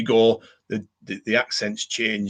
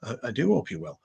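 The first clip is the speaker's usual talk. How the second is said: softer, stress on do; mock-posh repeat; 4 semitones higher, 8 dB quieter, faster than usual. Shown as a demonstration. Echoing the viewer again with a teasing, polite do. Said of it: "the Mickey taking is continuing".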